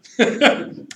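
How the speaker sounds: sudden high laugh; loud laugh. Real, not acted. Laughing at ex-nun reveal.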